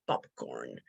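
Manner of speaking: "popcorn" said low and carefully, apart; concentrated, careful popcorn. Shown as a demonstration. Speaker concentrating to avoid the joke word, careful low popcorn. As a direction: drop the voice and place "popcorn" with care. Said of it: "I really have to concentrate".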